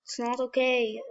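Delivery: usual speaking voice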